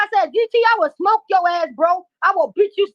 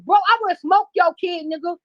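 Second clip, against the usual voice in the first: ordinary talk, nothing marked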